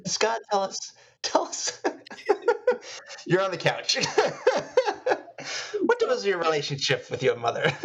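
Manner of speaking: a hint of a shrink's prompting, through laughter; lightly hammed-up therapist, laughing; mock therapist. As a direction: play the couch psychiatrist while cracking up.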